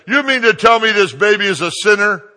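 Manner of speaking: loud, high, outraged woman yelling; angry yelling. Retold or imitated. Mother yelling at the preacher over her baby, angry yell. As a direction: shout it in indignant disbelief. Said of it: "She stands up yelling at me".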